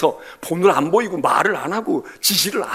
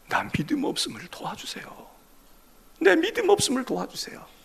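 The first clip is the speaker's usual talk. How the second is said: whispered plea; 9 semitones higher, 6 dB louder than usual. Acted. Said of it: "자기를 도와달래".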